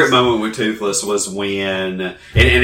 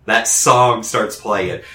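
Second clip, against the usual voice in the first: ordinary talk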